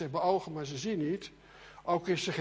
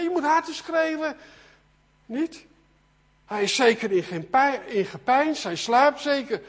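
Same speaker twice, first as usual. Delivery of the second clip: loud, high jeering; mocking taunts; 8 semitones higher, 8 dB louder than usual. Elia mocks prophets whose god sleeps, loud jeering. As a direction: taunt them with raised voice and feigned helpful guesses. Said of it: "het is een bespotting".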